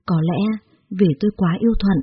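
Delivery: plain reading voice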